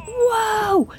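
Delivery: high startled cry; sudden shock as the doors close; alarmed whoa. Retold or imitated. Dog rides elevator alone.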